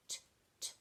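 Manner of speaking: unvoiced T, t tapped softly; whispered t sounds. Shown as a demonstration. Teacher isolating the t sound for children, whispered. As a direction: tap out T, t quietly. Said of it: "Can you hear the sound".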